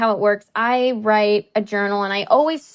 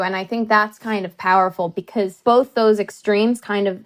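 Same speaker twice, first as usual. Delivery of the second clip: usual speaking voice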